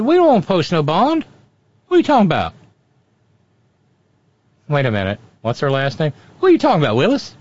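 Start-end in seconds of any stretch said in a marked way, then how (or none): 0.0-2.5 raised, indignant protest. Retold or imitated. Lawyer quoted, indignant. 6.4-7.3 loud, higher indignant retort; loudly offended, naming Willis. Retold or imitated. Same quote redone with name.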